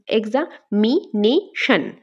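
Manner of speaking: "Examination" very slow, each part standing alone; syllable by syllable. Shown as a demonstration. Teacher recounting the four parts, "examination" said syllable by syllable. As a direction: pause between every part of "examination": exa, mi, ne, shan. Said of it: "এক্সামিনেশনকে আমরা part করলে".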